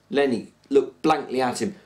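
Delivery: ordinary delivery, nothing marked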